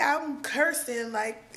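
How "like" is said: ordinary delivery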